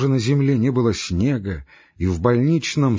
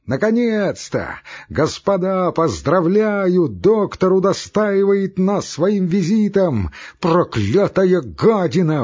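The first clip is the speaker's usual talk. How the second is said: much higher and louder, slow furious squeal; shrieking rage; 8 semitones higher, 3 dB louder, slower than usual. A narrator's reading. Ivan Dmitrich raging at the doctor, shrill furious shriek. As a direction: spit out the mocking welcome in a shriek. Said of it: "взвизгнул он".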